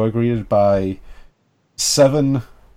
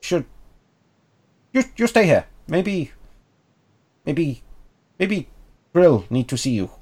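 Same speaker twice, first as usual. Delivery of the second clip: halting high kobold with pauses; little creature thinking aloud in broken bits; small creature voice; 5 semitones higher than usual. Acted. Kobold tells strangers to wait for Grill, small creature voice. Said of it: "trying to do a small creature accent".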